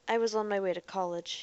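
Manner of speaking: usual speaking voice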